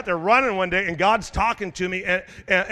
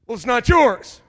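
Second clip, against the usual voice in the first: loud, higher, indignant retort; indignant objection; 6 semitones higher, 9 dB louder than usual. Retold or imitated. Upset members objecting to the giveaway, loud and indignant. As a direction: snap back at the pastor.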